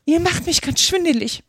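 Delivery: high-pitched queen's rebuke; heated scolding. A narrator's reading. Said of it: "eiferte die Königin". (eifernd, mit hoher Stimme)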